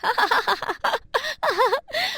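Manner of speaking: high, cackling schoolkid laugh; mocking laughter. Classmates jeering at Kamal in class, mocking laughter.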